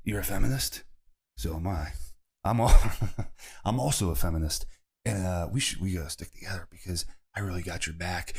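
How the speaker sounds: a low, mock sincere pickup line, lightly voiced; mock pickup guy. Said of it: "they probably get chicks by going".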